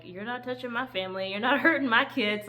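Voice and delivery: lightly voiced defiant parent; hint of a fierce parent guarding the kids; protective parent.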